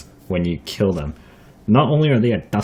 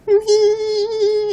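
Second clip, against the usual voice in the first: very high, sustained insect whine; imitated mosquito whine. Showing how the mosquito sounded by the ear, high imitated whine. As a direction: mimic a mosquito's piercing drone. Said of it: "that was a pretty good imitation there".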